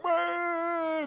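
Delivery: high mock wail of emotion; hammed-up crying wail; crowd wail. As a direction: let out a big sentimental wail. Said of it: "Everybody in the room's like".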